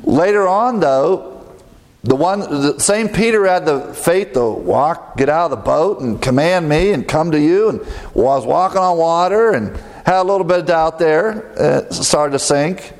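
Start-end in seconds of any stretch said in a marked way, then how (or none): none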